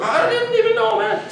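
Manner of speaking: squeaky-high retold defence; incredulous protest. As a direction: pitch shoots up, then drops. Acted protest to the boyfriend.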